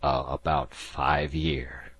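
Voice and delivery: slow and shaken, holding back; shaking voice. A narrator's reading. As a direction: quaver through the answer. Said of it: "said Disko in a shaking voice".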